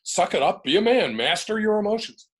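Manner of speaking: loud, high mock tough-guy orders; imitating a gruff coach; macho advice. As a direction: bark it like a drill sergeant.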